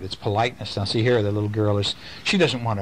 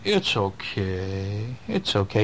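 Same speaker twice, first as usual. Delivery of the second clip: low, very slow soothing; comforting friend; 4 semitones lower, much slower than usual. Acted. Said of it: "the little friend is saying".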